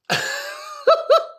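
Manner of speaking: a very high squeal of laughter. Real, not acted. Laughing at his own cheeky joke, very high squeal.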